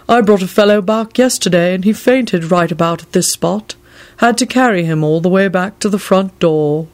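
put-on voice of an aggrieved servant; grumbling. A narrator's reading. Servant recalling the visitor who fainted, grumbling. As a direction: keep complaining, in character. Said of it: "he grumbled".

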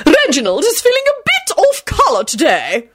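high, haughty grande dame. A narrator's reading. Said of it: "she announced haughtily".

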